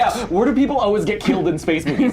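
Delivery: amused inner thought. Retold or imitated. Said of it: "I was like".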